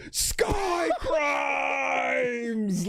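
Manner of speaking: high drawn-out shout; yelling. Retold or imitated. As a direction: bellow it across campus. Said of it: "just yelling out".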